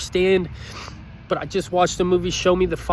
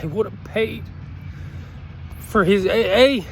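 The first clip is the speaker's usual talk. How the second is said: choked up. Real, not acted. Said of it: "I'm getting worked up".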